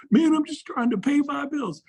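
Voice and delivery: whiny excuse. Pretend. Agent complaining about bills to the coach, high plaintive. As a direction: plead like someone just scraping by.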